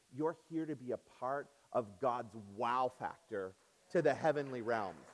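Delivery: ordinary talk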